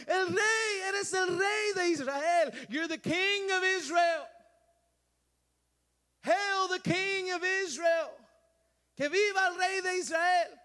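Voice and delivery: slow, high, loud royal acclaim; crowd shouting. Acted. Crowd hailing Jesus as king, shouted. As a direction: hail the king at the top of your voice. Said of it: "they were shouting right after that".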